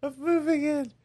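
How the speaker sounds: put-on high old lady voice, a little British; quavering granny voice, hint of British. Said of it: "She's a little British".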